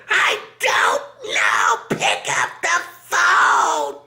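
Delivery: frantic screaming. Retold or imitated. Person at home screaming at Larry to just pick up. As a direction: wail it like you're at the end of your rope.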